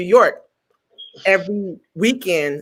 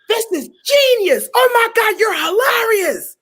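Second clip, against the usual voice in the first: delighted diva; 14 semitones higher than usual. Retold or imitated. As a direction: shriek with delight like a camp diva. Director delighted, very high.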